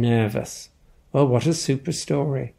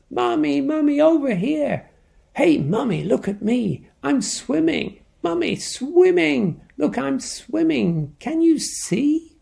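put-on much higher, bouncy child voice; excited little penguin; 12 semitones higher than usual. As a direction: squeal it like a thrilled little kid showing off. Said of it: "came a little voice she knew".

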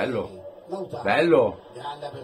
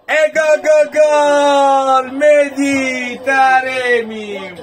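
long, loud goal roar; goal shout; 9 semitones higher, 6 dB louder, much slower than usual. Real, not acted. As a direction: belt out the goal and name. Fan celebrates penalty goal. (urlo di gol)

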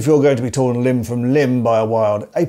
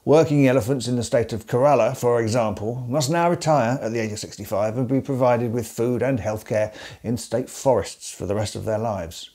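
ordinary delivery

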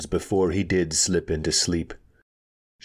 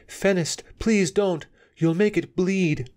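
anxious, very high-pitched pleading; Anika; 13 semitones higher than usual. A narrator's reading. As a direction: beg him to stop, voice pitched high with worry. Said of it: "said Anika anxiously".

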